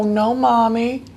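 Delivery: put-on high, slow little-kid voice; drawn-out childlike fib; child denying it. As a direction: play an innocent toddler caught out. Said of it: "And they go".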